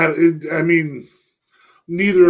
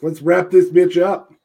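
slow, loud playful order; loud quote; 3 semitones higher, 8 dB louder, slower than usual. Retold or imitated. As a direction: belt out the wrap-up line. Quoting D'Lo loudly.

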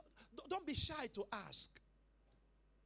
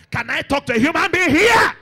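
very loud, climbing higher to the end; rousing shout; 6 semitones higher, 25 dB louder than usual. Real, not acted. Preacher rousing the congregation, shouting. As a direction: demand a response from the whole room.

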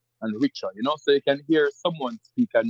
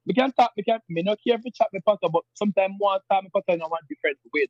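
fast, higher Jamaican patois; 7 semitones higher, faster than usual. Retold or imitated. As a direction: rattle off patois like an older Jamaican. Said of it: "And then can say".

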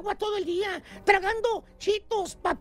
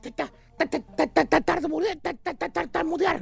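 imitating a nervous stutter, stuck on ta- over and over; mock stutter; 4 semitones lower than usual. Host mocking a stressed worker who stutters, mock stutter. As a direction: sputter the syllable like a stressed man who can't get it out. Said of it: "se pone nerviosón".

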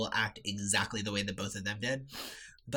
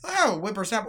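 put-on much higher, chipper old-man voice; imitating cheery grandpa; 8 semitones higher, 4 dB louder than usual. Granddaughter mimicking grandpa's chipper greeting. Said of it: "Or he would say".

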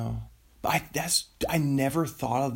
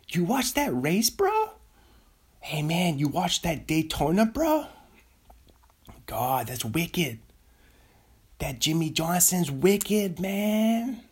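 higher-pitched Boston nascar bro; Boston accent; 6 semitones higher than usual. Pretend. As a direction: play a Boston guy hyped on racing. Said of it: "A Boston guy who's a nascar fan".

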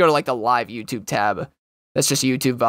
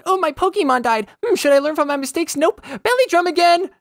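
high-pitched parody of a clueless player; mock raid player; 3 dB louder than usual. Streamer mimics players whose Pokemon died, high mocking voice. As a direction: squeak through it in exaggerated dismay.

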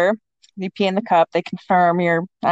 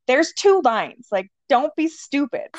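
higher, slow, drawn-out mock exasperation; stretched-out, higher retort, as if to someone in denial; exasperated insistence; 6 semitones higher, slower than usual. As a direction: spell it out slowly, imitating a fed-up boyfriend. Exasperated boyfriend insists on second test.

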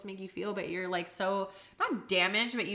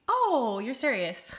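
high teasing surprised oh; mock surprise; 5 semitones higher, 5 dB louder, slower than usual. Woman teasing serious date, high mock surprised. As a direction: tease him with fake shock.

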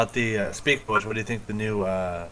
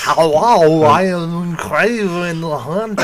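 put-on slow loud Russian accent, higher; Schwarzenegger Russian accent; 8 semitones higher, 8 dB louder, slower than usual. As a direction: Austrian doing a Russian accent. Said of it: "I want to see Arnold Schwarzenegger do a Russian accent".

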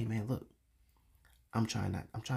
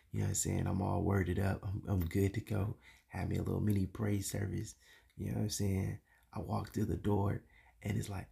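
ordinary talk

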